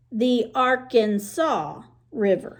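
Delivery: "Arkansas" said AR-kan-saw; "Arkansas" ending in saw, slow; Arkansas pronunciation. Shown as a demonstration. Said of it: "you say it like that".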